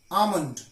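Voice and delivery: ALMOND as "ah-mund", with no L; silent L. Shown as a demonstration. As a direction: drop the L in ALMOND. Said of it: "L is silent in these words".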